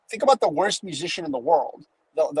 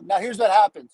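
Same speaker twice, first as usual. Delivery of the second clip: normal talking voice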